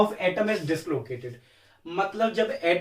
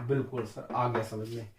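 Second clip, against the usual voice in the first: a lower, softer student voice agreeing; pupil's quiet reply; 7 semitones lower, 8 dB quieter than usual. Pretend. Teacher voicing a pupil who says he understands, lower and softer. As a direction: play the pupil who finally gets it.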